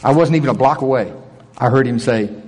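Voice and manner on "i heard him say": usual speaking voice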